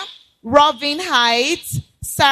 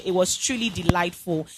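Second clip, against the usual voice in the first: ordinary talk, nothing marked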